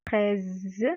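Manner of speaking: "Fraise" slowly, with a held z ending; sound demonstration. Shown as a demonstration. Teacher showing the sound of S, "Fraise" ending on a long z. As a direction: hold the z at the end of "Fraise". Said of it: "qui nous a donné le son".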